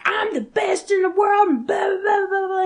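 high, hammed-up wrestling promo voice; mock a wrestler bragging, trailing into blahs; boastful wrestler. Imitating a wrestler's promo for a friend, hammed-up boasting.

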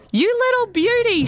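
high, thrilled squeal of joy; delighted squeal. Acted. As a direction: squeal it out like a girl who just got her wish. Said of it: "she squealed".

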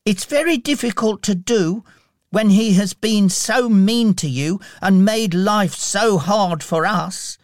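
put-on high-pitched little-boy voice; Tiny Tim. A narrator's reading. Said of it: "said Tiny Tim".

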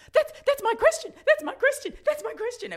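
high, loud excited squealing. Retold or imitated. Young reporter showing her parents the TV news, excited squeal. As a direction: gush like you can't contain yourself.

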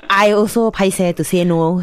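ordinary delivery

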